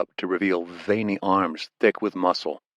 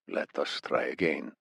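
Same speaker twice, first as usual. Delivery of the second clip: menacing interrogator; 5 semitones lower, 9 dB quieter than usual. A narrator's reading. Amir interrogating.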